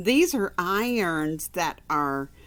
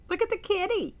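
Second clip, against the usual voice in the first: much higher cutesy look at the kitty; delighted squeal; 14 semitones higher, much faster than usual. Acted. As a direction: coo over the cat in a child's voice. Reader delighted by picture cat.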